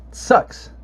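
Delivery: ordinary delivery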